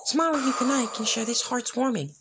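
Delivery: put-on high, sweet girl's voice; a small pony coaxing a smile; little filly voice. A narrator's reading. Filly cheers up the sad man, high and sweet.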